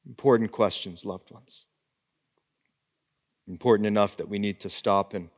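hushed pastor urging hearts to search; soft pastoral reflection. Acted. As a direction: draw the church into quiet self-examination. Hushed reflective close of sermon.

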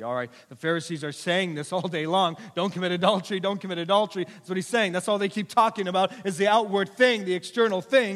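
high, loud, driving sermon voice; full-force preaching. Real, not acted. Preacher hammering the Pharisees' outward rules, full-force preaching. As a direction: preach it loudly and insistently.

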